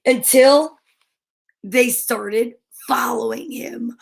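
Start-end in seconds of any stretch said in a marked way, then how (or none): none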